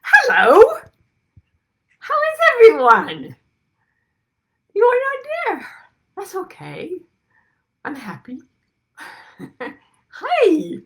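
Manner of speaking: put-on high, sing-song baby talk; gushing high-pitched baby talk. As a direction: coo at them like a six-week-old baby. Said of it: "talking to you all like you're a six-week-old baby".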